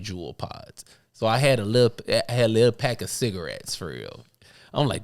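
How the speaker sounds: ordinary delivery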